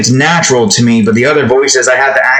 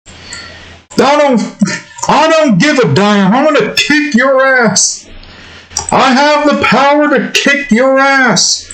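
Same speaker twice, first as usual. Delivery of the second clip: exasperated, higher-pitched cartoon dad threat; Hank Hill; 9 semitones higher, slower than usual. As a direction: scold your son in a put-on cartoon voice. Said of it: "I can get a good Hank Hill".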